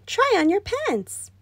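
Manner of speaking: high, slow coaxing; lightly voiced wife; wife urging. A narrator's reading. Wife urges.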